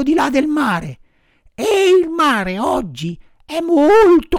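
put-on high, loud anxious old woman; shrill-free high elderly voice, distressed. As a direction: tell it like a worried old lady. Old woman explains the danger.

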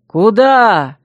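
loud, drawn-out cry of alarm; exclaiming. A narrator's reading. Two suitors cry after the jumping bride, exclaiming. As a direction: call after someone escaping. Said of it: "в один голос воскликнули женихи".

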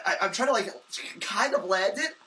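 ordinary delivery

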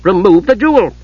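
high-pitched, sharp demand; sharpened voice. A narrator's reading. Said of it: "Kitiara's voice sharpened".

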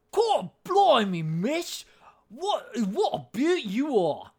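swallowed Cockney, high, slow and very loud. Acted. Creepy chimney sweep to a miss in a play, loud swallowed Cockney. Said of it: "it's really loud and it's really, like, swallowed".

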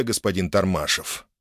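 ordinary delivery

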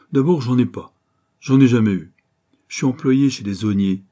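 lightly voiced gamin, emphatic; blunt urchin shrugging off having no parents; cocky street kid.

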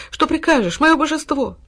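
high, devoted dog voice; fawning dog. A narrator's reading. Dog fawning at his master's feet. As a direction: grovel sweetly.

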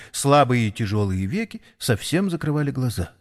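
plain reading voice

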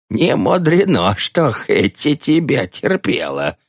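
cackling. Acted. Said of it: "прогоготала она".

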